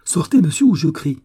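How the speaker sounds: high, loud, forceful order; terrified energy. A narrator's reading. Young woman orders the intruder out, frightened and forceful. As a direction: threaten with energy born of terror. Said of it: "répliqua la jeune fille avec une énergie".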